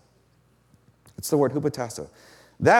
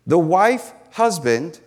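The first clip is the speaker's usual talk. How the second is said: usual speaking voice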